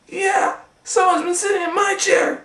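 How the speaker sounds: squeaky high bear voice; Mama Bear. Acted. Mama Bear finding her chair used, squeaky and high. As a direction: squeak it like a fussing mother bear. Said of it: "said Mama Bear".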